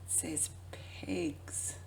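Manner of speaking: soft whispered aside. Acted. Pointing out the peg box label, whispered. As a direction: murmur it like a secret.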